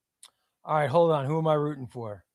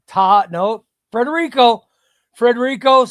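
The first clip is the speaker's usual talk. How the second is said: loud, higher name call; excited callout; 4 semitones higher, 9 dB louder than usual. Real, not acted. Streamer reacting as the wheel picks a viewer, loud surprised callout.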